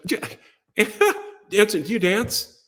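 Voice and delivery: high question broken by laughs; mock helpless laughing voice; crying with laughter. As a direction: imitate someone asking through hysterics. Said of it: "They're crying w-, with laughter".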